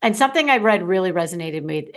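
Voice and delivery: ordinary delivery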